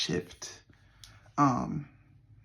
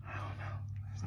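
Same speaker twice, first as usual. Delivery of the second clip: whispering; 13 dB quieter, slower than usual. Real, not acted. Whispered aside.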